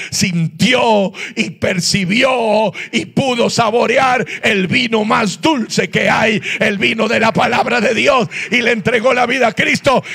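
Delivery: impassioned sermon climax, easing off at the end; fervent preaching. Real, not acted. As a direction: build the testimony into a triumphant proclamation. Preaching climax at full force.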